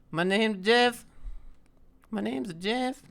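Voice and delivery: high put-on accented meme voice; high-pitched meme impression; Jeff meme voice. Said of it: "Say, "My name is Jeff" meme".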